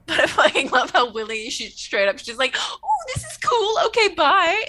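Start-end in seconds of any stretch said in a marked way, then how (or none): 2.6-4.6 put-on much higher, breezy kid voice; a small child shrugging it off cheerfully; carefree little girl.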